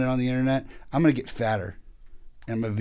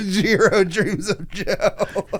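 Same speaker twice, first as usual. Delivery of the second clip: slow, laughing title pun; laughing through it; 8 semitones higher, much slower than usual. Real, not acted. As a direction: stretch it out while cracking up.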